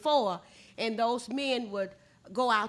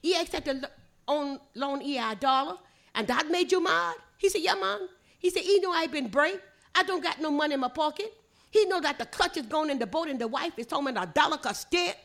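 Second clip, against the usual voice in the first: higher-pitched Gullah back-and-forth; 4 semitones higher than usual. Shown as a demonstration. Storyteller continuing the two friends' quarrel, in higher Gullah. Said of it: "Listen at it in Gullah".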